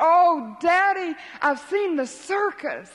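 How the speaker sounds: put-on high, loud child's cry of joy; bursting to tell his daddy; excited boy. Boy runs home bursting with news.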